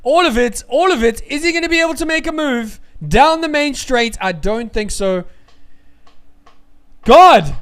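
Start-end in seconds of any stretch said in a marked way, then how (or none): 0.0-4.2 fast, keyed-up race call; excited commentary. Real, not acted. Fan commentator calling a battery battle, excited. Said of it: "I'm not gonna have a voice". 7.0-7.6 a loud cry of disbelief; shouted God. Real, not acted. Streamer losing his voice over the race, shouting. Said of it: "I'm not gonna have a voice".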